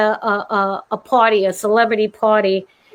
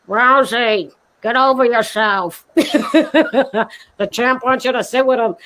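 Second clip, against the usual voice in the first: teasing; slower than usual. Retold or imitated. Penny Marshall quoted teasing.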